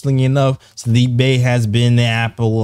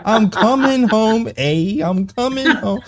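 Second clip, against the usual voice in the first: high, slow Canadian "I'm coming home, eh"; hammed-up Canadian homecoming line; Canadian accent; 10 semitones higher than usual. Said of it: "With a Canadian accent".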